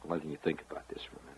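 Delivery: fast breathy whisper, no voice at all. Acted. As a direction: hurry it out in a hush, letting it sink in. Host pausing to let listeners brood.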